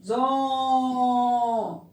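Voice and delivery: Zom held very long on the vowel; lingering syllable. Shown as a demonstration. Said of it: "Demoramos aqui".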